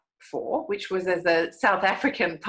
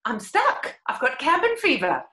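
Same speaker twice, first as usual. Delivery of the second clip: high, frazzled complaint; exasperated inner voice; 8 semitones higher, 4 dB louder than usual. Retold or imitated. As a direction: play it trapped and fed up. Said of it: "I just was thinking".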